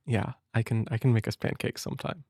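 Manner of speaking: ordinary talk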